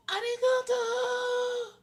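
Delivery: high, slow, drawn-out Japanese "Arigato!"; mock Japanese thanks. Streamer jokes about Japanese subtitles, long high mock Japanese "Arigato!".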